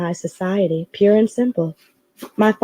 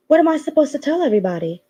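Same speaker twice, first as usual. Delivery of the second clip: quick, higher, rushed worry; flustered; 7 semitones higher, faster than usual. Acted. Secretary panicking about what to tell everyone, rushed. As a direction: blurt it out in a fluster.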